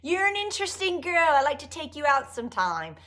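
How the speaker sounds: high-pitched, hammed-up British playboy; mock British swinger chatting someone up; Austin Powers. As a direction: put on a cartoonish British come-on. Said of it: "let's just vision him as Austin Powers".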